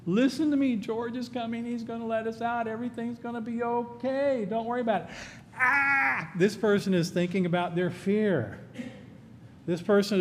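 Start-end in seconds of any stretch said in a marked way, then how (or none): 0.1-5.1 soft, high, gentle calming voice; soothing reassurance. Acted. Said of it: "trying to assure the guy who's freaking out". 5.6-6.3 panicked cry. Acted. Panicker cries out.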